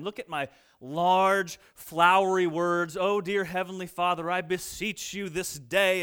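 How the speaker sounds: slow, loud, grandly drawn-out prayer; a hammed-up pious show-off; pompous mock prayer. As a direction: pray to be overheard, milking every word. Mock flowery public prayer.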